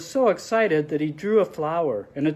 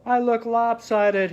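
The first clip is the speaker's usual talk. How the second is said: mopey; 7 semitones higher, 3 dB louder, slower than usual. Acted. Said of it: "he said".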